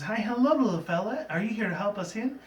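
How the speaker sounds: a shy pony greeting a small bird; sweet Fluttershy. Retold or imitated. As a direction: coax the little bird gently and high. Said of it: "What happens is".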